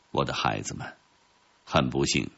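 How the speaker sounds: put-on low, soft elderly counsel; lightly voiced wise old professor. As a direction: speak gently down to the children, low and quiet. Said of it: "老教授也曾用通情达理".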